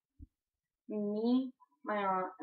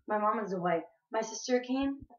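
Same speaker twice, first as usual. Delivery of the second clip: ordinary delivery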